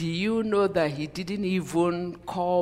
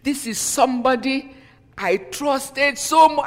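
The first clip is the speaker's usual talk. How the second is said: higher and louder, slowing on the last words; shocked outcry; 7 semitones higher, 6 dB louder than usual. Retold or imitated. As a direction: cry out in hurt disbelief. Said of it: "I was like".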